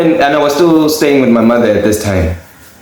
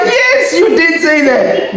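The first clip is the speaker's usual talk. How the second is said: much higher, laughing yes; high laughing insistence; 13 semitones higher, slower than usual. Retold or imitated. Son to his forgetful mother, a high laughing insistence. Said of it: "I'm like".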